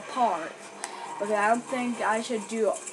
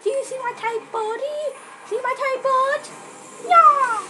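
breathy cutesy giggly; 14 semitones higher, 5 dB louder, slower than usual. Pretend. Playful showing off in game.

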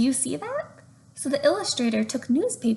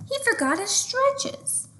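surprised realization; 14 semitones higher than usual. A narrator's reading. Sudden realization in story.

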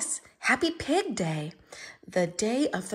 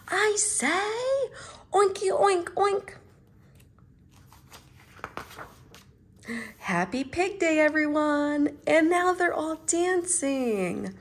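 high, loud happy oinks; high, loud party cheer; joyful character voice; 8 semitones higher, 4 dB louder than usual. Acted. Happy ending of the book.

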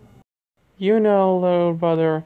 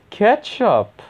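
excited; 9 semitones higher, 5 dB louder than usual. Pretend. Excited find.

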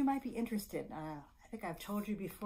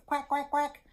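high, loud quacking; three quick quacks like a duck; 7 semitones higher, 8 dB louder than usual. Pretend. Storyteller showing what the duck says, playful quacks. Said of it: "And the duck says".

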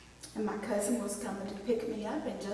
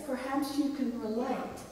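normal talking voice

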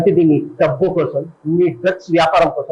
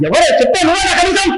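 furious shout; 14 semitones higher than usual. Acted. Auditioner picks a fight, screaming who dares. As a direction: roar a challenge at the crowd. Same challenge.

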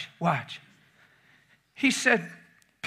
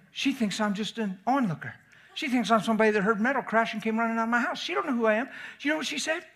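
normal talking voice

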